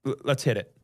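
usual speaking voice

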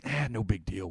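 soft, offhand shrug of a line; no big deal. Retold or imitated. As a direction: play it down like it's nothing, quietly. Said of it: "in a very, like, ... way".

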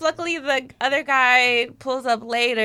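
ordinary talk

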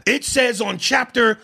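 loud, high, slow declaiming; mock rights-reciting. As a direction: spout the rulebook like a defiant citizen. Acting out someone reciting rights at police.

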